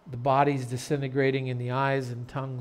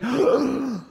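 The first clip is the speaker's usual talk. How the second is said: loud mock gasp; congregation's gasp; 11 semitones higher, 5 dB louder than usual. Whole congregation shocked at the farmer, mock gasp. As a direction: suck in air in exaggerated shock.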